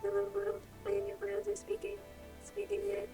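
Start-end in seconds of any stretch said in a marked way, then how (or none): none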